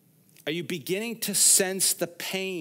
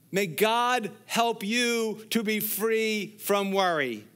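slow, raised pastoral blessing; 6 semitones higher, 4 dB louder, slower than usual. Acted. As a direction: bless the congregation deliberately. Blessing prayer over congregation.

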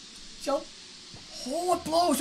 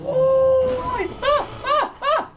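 panicked yelps; 7 semitones higher, 5 dB louder, much slower than usual. Real, not acted. Dodging rocks in panic.

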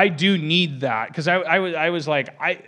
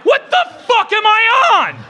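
much higher, loud shouted question; mock outrage; 11 dB louder than usual. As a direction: yell it as an indignant passenger. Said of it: "I have a right to be like".

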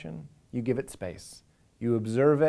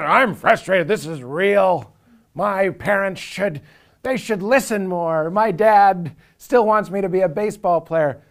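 higher, louder, whining self-pity; whiny ego; 8 semitones higher, 8 dB louder than usual. Retold or imitated. Mocking his own false self to the listeners, a loud high whine. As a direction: wail it like a grievance that feels completely real.

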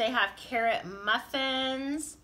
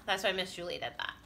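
ordinary delivery

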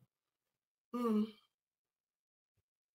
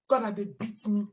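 breathy whisper; hushed complaint; whispered lament; 4 semitones lower, 5 dB quieter than usual. Real, not acted. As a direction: whisper it in grief. Lamenting beating.

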